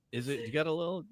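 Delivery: quiet. Real, not acted. Playful soft ask for gin.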